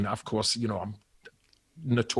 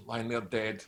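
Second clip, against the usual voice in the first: ordinary talk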